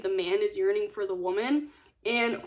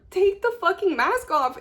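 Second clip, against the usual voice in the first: higher, louder exasperated shout at the book; frustrated yell; 7 semitones higher, 5 dB louder than usual. Retold or imitated. Reader shouting in frustration at the heroine, exasperated higher louder. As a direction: yell at her to just take it off. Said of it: "you as the reader are so frustrated".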